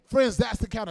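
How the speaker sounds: loud, rolling preacher's cadence; preaching at full force. Real, not acted. Preacher rousing the congregation about healing the outcasts, full-force preaching. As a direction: preach it out to the whole church.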